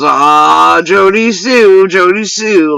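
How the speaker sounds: loud, drawn-out mock cry; imitating his outburst. Imitating what he said on the panel, mock outburst. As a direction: stretch it out and lift the pitch, mimicking him. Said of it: "He goes".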